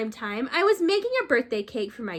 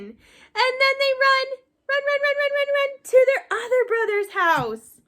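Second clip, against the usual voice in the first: high, lively run run run; excited; 12 semitones higher, 4 dB louder than usual. Acted. Telling kids the pigs flee, high and excited. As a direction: pile up the runs with excitement.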